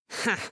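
short raspy bark of a laugh; loud hoarse sailor's laugh. Acted. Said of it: "Моряк хрипло рассмеялся".

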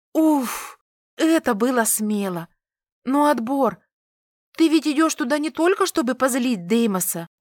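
fast, high breathless patter; rapid chatter. A narrator's reading. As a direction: gabble it out while hurrying. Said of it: "тараторила она на ходу".

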